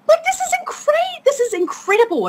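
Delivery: very high, squealed disbelief; high, excited marvelling; retold amazement. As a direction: shoot the pitch up in astonishment. Retold amazement at online hero.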